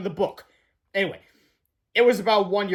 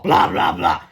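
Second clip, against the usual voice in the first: mock grumble; 3 semitones lower, 7 dB louder, slower than usual. Principal grumbling after change.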